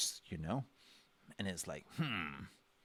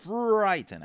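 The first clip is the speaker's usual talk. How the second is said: dramatic emphasis; 11 semitones higher, 11 dB louder than usual. Real, not acted. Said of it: "this person is frightened".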